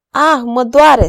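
cry of pain. A narrator's reading. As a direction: cry out as the wrist is squeezed. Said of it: "strigă Gileta". (strigăt de durere)

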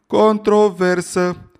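high, loud, slow drawn-out chant-yell; crowd yell. Acted. Students roar at the lecturer together, high loud yell. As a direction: bellow it like a hundred students at once. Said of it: "o sută de glasuri urlau".

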